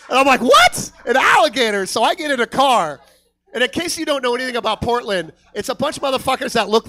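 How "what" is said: very high, loud yelp; shocked disbelief. Retold or imitated. Comic hearing of Craigslist alligator, shocked high yelp. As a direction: shriek it in mock disbelief.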